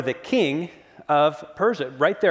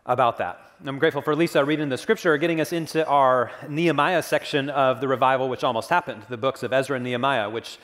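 ordinary delivery

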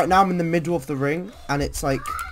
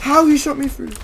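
outraged disbelief; 11 semitones higher, 8 dB louder than usual. Real, not acted. Shot by enemy in game.

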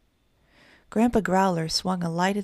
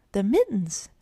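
mild storybook shout, pitched up a touch; shouted exclamation; 3 semitones higher, 3 dB louder than usual. A narrator's reading. Three bears answering about mittens, mildly shouted. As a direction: exclaim it with a lift, not full volume. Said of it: "shouted the three bears in one breath".

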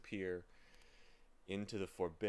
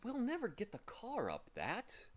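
put-on higher, glum boyish complaint; unhappy; 13 semitones higher than usual.

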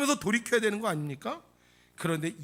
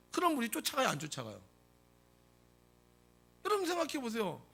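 ordinary talk, nothing marked

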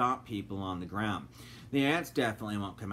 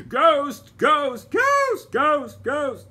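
screaming ghost over and over, very high and loud; frightened shrieks of ghost; terrified screams; 12 dB louder, much slower than usual. A narrator's reading. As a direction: shriek in panic as you run. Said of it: "they screamed".

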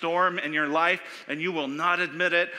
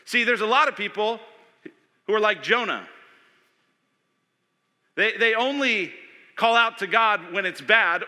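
higher, louder preaching push; full-force preaching; 8 semitones higher, 9 dB louder than usual. Acted. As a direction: drive the point home to the congregation.

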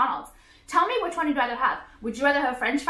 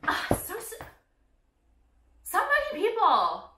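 high, dragged ugh so many people; exasperated groan; 9 semitones higher than usual. Real, not acted. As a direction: groan it out fed up. Exasperated sigh at viewers.

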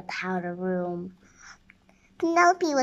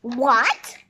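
louder, mildly outraged cry; furious cry; 2 semitones higher, 8 dB louder than usual. A narrator's reading. Princess told she failed the test, mildly furious cry. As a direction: cry it out with a touch of fury. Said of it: "cried the princess. She was furious".

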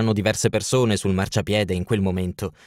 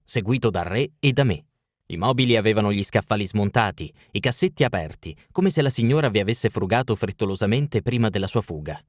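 ordinary delivery, nothing marked

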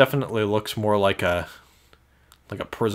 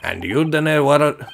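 loud, higher stab at Scottish dialect; attempted Scottish accent; 6 semitones higher, 6 dB louder than usual. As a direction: stumble loudly through a Scottish accent. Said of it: "I can't do a Scottish accent".

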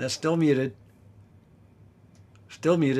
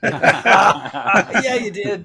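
higher, laughing through the words; laughing agreement; 5 semitones higher, much slower than usual. Real, not acted. Bandmates joking about a [name] likeness, laughing.

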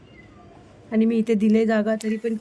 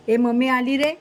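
loud call to the pet; 2 semitones higher, 6 dB louder than usual. Real, not acted. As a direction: announce mummy is back, loudly. Calling to the parrot. (मोठ्याने हाक)

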